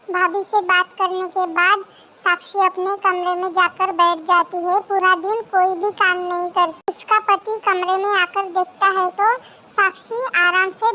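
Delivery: story narration. A narrator's reading. Narrator quietly describes what the daughter-in-law does, soft storytelling. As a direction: narrate the scene softly, like a story told.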